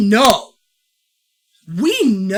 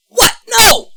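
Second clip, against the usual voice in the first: mock shout; 13 semitones higher, 7 dB louder than usual. Mock horror at Nan dying.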